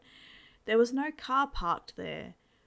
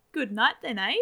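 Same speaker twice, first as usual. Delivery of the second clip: louder, a lightly voiced jovial father; teasing dad; 2 semitones higher, 7 dB louder than usual. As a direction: tease the son like a cheeky dad. Dad teasing son at breakfast.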